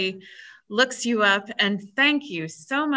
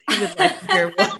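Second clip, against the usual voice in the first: laughing; 11 semitones higher, faster than usual. Real, not acted. Asks guest for howl, laughing.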